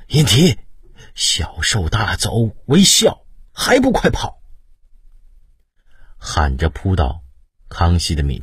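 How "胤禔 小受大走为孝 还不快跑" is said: urgent shout. A narrator's reading. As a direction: yell at the prince to run. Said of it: "方包情急大喊".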